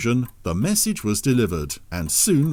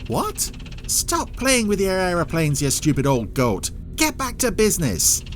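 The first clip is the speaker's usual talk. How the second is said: shrill, much higher furious rant; angry outburst; 8 semitones higher than usual. Acted. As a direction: tear into the old goat. Boss yells at partner.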